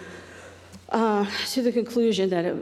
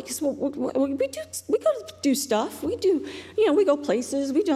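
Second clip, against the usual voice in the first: flustered high defense; 6 semitones higher than usual. Retold or imitated.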